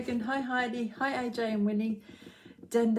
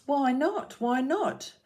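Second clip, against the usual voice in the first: put-on curious child; 7 semitones higher, 4 dB louder, slower than usual. Child character asks, story voiced.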